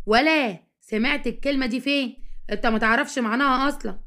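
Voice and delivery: plain reading voice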